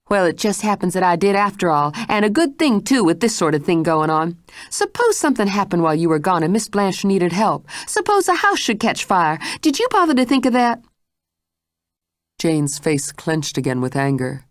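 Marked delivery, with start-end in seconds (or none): 0.1-10.8 higher, rising indignation, faster at the end; a housekeeper scolding with growing heat; scolding Mrs Stitt. Acted.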